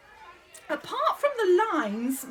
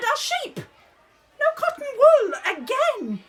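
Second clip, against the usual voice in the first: sharp raised telling-off, higher and louder; exasperated scolding; 8 semitones higher, 5 dB louder than usual. Real, not acted. Drama teacher to child cast, exasperated loud scolding. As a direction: scold the whole cast at once.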